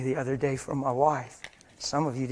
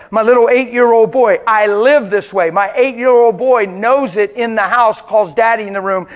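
loud, passionate pulpit preaching with a higher pitch; full-force preaching; 3 semitones higher, 11 dB louder than usual. Acted. Pastor urging the congregation not to sin in anger, preaching loudly.

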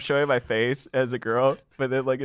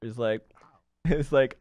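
normal talking voice

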